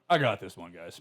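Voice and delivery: low, lightly voiced guard; security guard. Security guard claiming the female streaker, low mock authority. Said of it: "the security guard's like".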